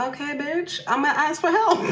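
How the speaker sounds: high, cheeky give-in; sassy retort. Retold or imitated. Retorting to ayahuasca in memory.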